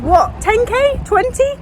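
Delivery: high, incredulous question; jogger's shocked "what?"; surprised old guy. Retold or imitated. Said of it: "And he said".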